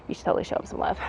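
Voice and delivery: soft unvoiced aside; whispering. Real, not acted. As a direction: whisper it to the viewers. Aside to viewers after husband intro.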